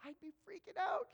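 high-pitched alarmed aside; mock panic. Onlooker compares himself to calm Richard, mock-panicked. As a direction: squeak it as if freaking out. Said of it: "he's so calm. I'm like".

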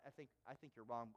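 shaky denial, voice dropping away; mild retold panic, quiet and hurried; panicky. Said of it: "So I, I start to panic".

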